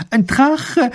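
high, loud call across the house; calling from afar. A narrator's reading. Wife calls to her husband from a distance, loudly. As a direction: shout it from across the room. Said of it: "ruft sie ihm schon von Weitem zu".

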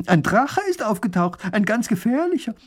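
high, agitated announcement; alarmed news-breaking. Acted. Wife warning the neighbour of a dragon, alarmed. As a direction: spread the alarm, pitch climbing.

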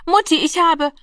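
put-on high, excited children's cries; jubelnde Kinder. A narrator's reading. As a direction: call out in delight like a child. Kinder jubeln bei der Bescherung. (aufgeregt jubelnde Kinderstimmen)

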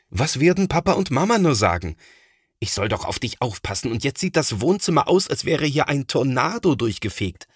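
fast, high, worried scolding. A narrator's reading. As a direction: rattle it off in a panic about the parents. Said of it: "schimpft Thomas". (schnell und aufgebracht schimpfend)

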